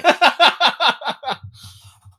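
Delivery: loud burst of laughter. Real, not acted. Laughing at punched by train.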